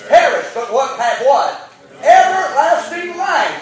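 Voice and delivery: loud call-and-response, building; full-force preaching. Real, not acted. Preacher building call and response with his congregation, loud. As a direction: drive the congregation to the answer.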